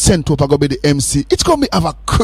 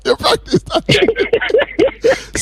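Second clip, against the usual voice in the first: high, slow, sung-out teasing; singing the phone number back; mock sing-song; much slower than usual. Shown as a demonstration. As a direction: stretch it high and slow like a jingle. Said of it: "I think you practice that three there".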